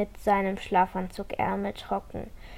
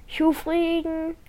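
slow mumble through a retainer; mumbling with a retainer; 7 semitones higher, slower than usual. A narrator's reading. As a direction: mumble around the braces in your mouth. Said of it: "nuschelt er".

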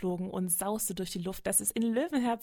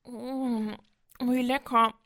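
munching caterpillar voice, with a loud burst on wie lecker; a lightly voiced happy caterpillar mid-snack; greedy caterpillar; 3 semitones higher, 3 dB louder, slower than usual. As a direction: savour each bite aloud.

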